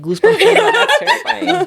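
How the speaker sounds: creeped out and laughing, high-pitched. Real, not acted. Nervous laughing at the scary story.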